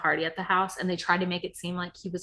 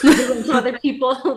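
laughing; 6 semitones higher, 10 dB louder than usual. Retold or imitated. Retold panic, laughing.

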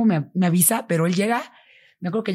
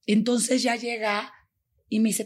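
usual speaking voice

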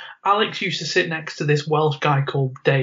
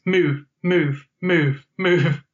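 steady rhythmic repeated move, mimicking a shove on each word; shown pace; much slower than usual. Shown as a demonstration. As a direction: chant move in a steady pushing beat. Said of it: "he's doing it at this pace".

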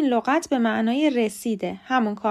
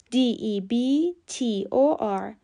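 ordinary talk, nothing marked

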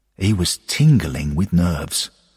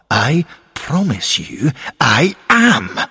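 higher, slow, heartfelt promise; emphatic insistence; 7 semitones higher, 3 dB louder, slower than usual. Acted. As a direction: insist word by word, drawn out. Insisting against friend's doubt.

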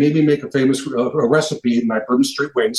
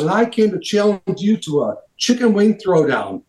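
raised-pitch showman's challenge; retold celebrity challenge, pitched up; imitated challenger announcement; 6 semitones higher than usual. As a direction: announce the contest to a cheering crowd. Quotes celebrity announcing challenge to crowd.

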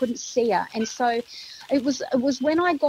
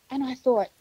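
ordinary delivery

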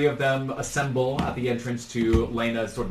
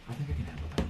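soft, covert reply; hushed conspirator; 2 semitones higher, 9 dB quieter, much faster than usual. Acted. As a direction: answer quietly among plotters. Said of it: "they are very much whispering".

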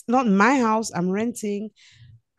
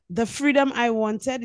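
ordinary talk

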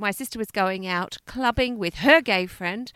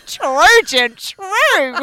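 loud, high, theatrical exclamation; hammed-up catchphrase; 7 semitones higher, 10 dB louder, much slower than usual. Niece parodying her uncle's saying to co-host, hammed-up. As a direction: camp it up, high and booming.

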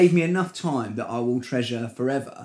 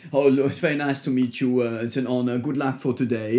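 Wenger impression. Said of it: "So I just was like".

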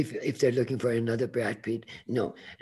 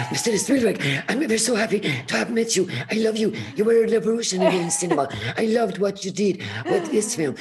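high, fast, loud star-struck gushing; gushing excitement; 10 semitones higher, 7 dB louder than usual. Retold or imitated. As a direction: gush at your hero, breathless with excitement. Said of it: "I was so excited".

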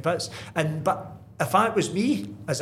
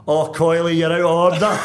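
loud, laughing mock telling-off; laughing rebuke; 3 semitones higher, 9 dB louder than usual. Manager laughs off the cheeky player's joke, loud laughing rebuke. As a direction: scold him while cracking up. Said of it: "and he was like".

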